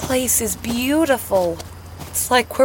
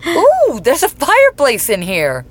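loud, excited burst with a high "ooh"; 4 semitones higher, 6 dB louder than usual. Acted. Guest spotting a fireplace in the luxury suite, excited. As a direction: blurt it out in delighted excitement. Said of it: "I got excited".

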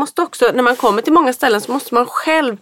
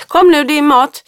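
calling out; 4 semitones higher, 5 dB louder than usual. Retold or imitated. Mother calling kids to eat.

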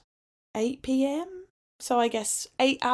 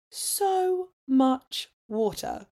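slowly stretched, marvelling; drawn-out emphasis; 4 semitones higher, much slower than usual. Real, not acted. Telling viewers how much water there was, slowly stretched. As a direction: drag out each word in disbelief.

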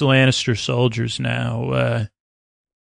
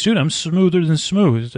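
higher, swaggering dude voice, hamming up the brag; cocky rock-star pirate; 5 semitones higher than usual. Retold or imitated. Euron bragging to Cersei, hammed-up smug higher voice. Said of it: "And he goes".

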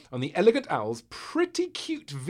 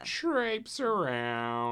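drawn out slowly; much slower than usual. Shown as a demonstration. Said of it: "a bit of a traipse of a word".

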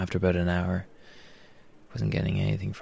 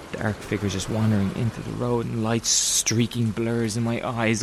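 usual speaking voice

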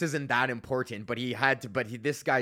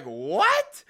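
loud, higher-pitched mock disbelief; incredulous; 4 semitones higher, 5 dB louder than usual. Man retelling his reaction to a stalking accusation, incredulous. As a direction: blurt it out, stunned.

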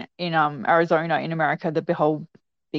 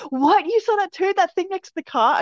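much higher, amazed exclamation; retold surprised outburst; 11 semitones higher than usual. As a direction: act out startled, excited disbelief. Retelling sisters' startled, excited reactions.

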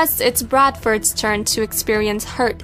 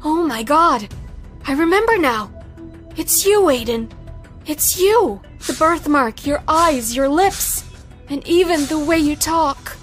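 very high, tearful woman's voice. A narrator's reading. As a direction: cry out in high-pitched, tearful recognition. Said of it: "she started crying and said".

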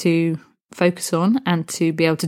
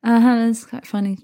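unimpressed; 5 semitones higher than usual. Pretend.

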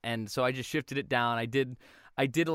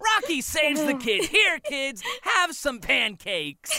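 cartoon cat voice; 12 semitones higher, 4 dB louder, slower than usual. Joking in the character voice.

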